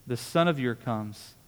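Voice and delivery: slow, leaning hard on "son" in "This son of yours comes"; slow down and hit "son" with a falling tune; stress on son. Shown as a demonstration. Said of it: "notice he doesn't say".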